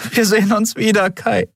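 high, called-out promise; promising. A narrator's reading. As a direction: call a heartfelt promise to someone far off. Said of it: "versprach sie".

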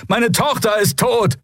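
very high anguished shout; anguished outcry. A narrator's reading. Bearded man on his dead daughter, anguished shout. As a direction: cry out the loss in anger. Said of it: "Wieder wurden erzürnte Rufe laut".

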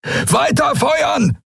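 high, slow, drawn-out battle command; bellowed order. Acted. Thelios orders his ships to keep firing, bellowing. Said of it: "brüllte Thelios".

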